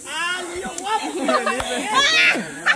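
drawn-out loud holler; wołanie z zachwytem. Real, not acted. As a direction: shout it out, stretching the words. Playful hollering at a contestant.

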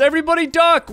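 high, loud warning to the team; calling out. Real, not acted. Warning teammates about flying cards, loud call. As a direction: yell at everyone to duck.

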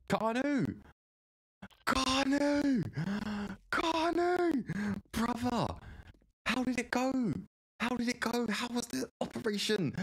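high-pitched, elated calling out to a friend; thrilled, squealing-high welcome; excited greeting. Real, not acted. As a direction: burst with joy at a returning pal, pitch way up. Greeting returning friend, eagerly asking news.

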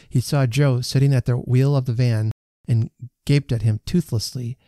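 plain reading voice

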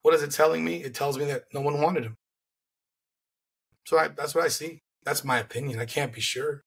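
normal talking voice

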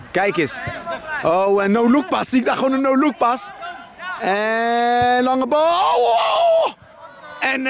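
put-on excited sportscaster calling a kids' match, pitch up; live play-by-play. Parent mock-commentating son's football match, excited play-by-play. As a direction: commentate the game like a TV broadcaster.

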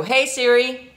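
ordinary talk, nothing marked

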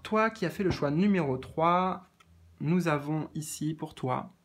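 ordinary talk, nothing marked

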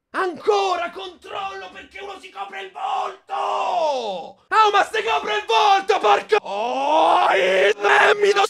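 high, loud, outraged yelling; furious shout. Real, not acted. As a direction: rage at the referee. Outraged fan at VAR check.

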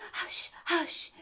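breathy urgent hushing; low, hurried. A narrator's reading. Rabbit silences Alice in a low, hurried voice. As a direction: shush them under your breath. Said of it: "in a low, hurried voice".

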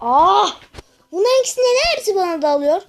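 loud, high yell of outrage; outraged yell. Real, not acted. Player yells as every enemy attacks him, outraged shout. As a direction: yell as everyone piles on you.

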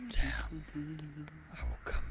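deep, eerie demon whisper; low, slow whispered demon voice; demonic voice. Acted. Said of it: "deep, eerie voice, that's me".

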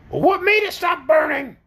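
high-pitched creature voice; questioning orc. Acted. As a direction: ask up high as a baffled orc. Orc asks in figure voice.

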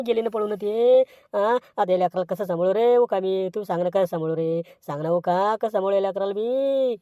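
ordinary talk

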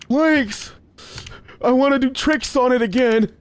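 high whiny mock Freddy; voicing Freddy. Streamer voicing Freddy begging to play, high whiny voice. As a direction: whine it like a pestering fan. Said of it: "Freddy about to be right there".

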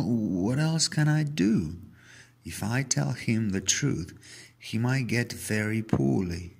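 plain reading voice

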